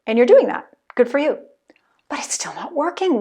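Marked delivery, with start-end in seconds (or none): 2.1-3.2 hushed mock despair, breath only; whisper. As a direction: whisper it like a secret failure. Mock frustration, speaking for listener.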